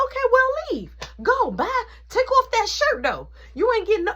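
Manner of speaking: high, loud furious shout; angry yell. Retold or imitated. Mom yelling at Beth to leave, shouting. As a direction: blast her out of the house, pitched high.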